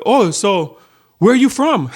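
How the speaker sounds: bright questioning rise, pitched high; curious tourist. Retold or imitated. Hotel guest asking a worker where she's from, lightly voiced high question. Guest asks her origin.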